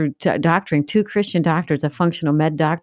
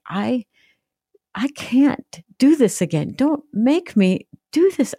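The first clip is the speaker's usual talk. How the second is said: high, slow desperate plea; anguished high pleading; pleading cry; 9 semitones higher, slower than usual. Retold or imitated. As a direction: beg God through tears, pitch up high. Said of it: "And I'm like".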